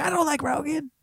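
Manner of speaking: high mock voice. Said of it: "Joe's gonna be like".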